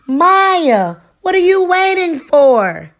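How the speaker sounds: shouting over the roar. A narrator's reading. Raphael shouts to Maya over roaring engines, loud and urgent. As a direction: yell across the noisy track. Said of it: "Raphael shouted out over the roar".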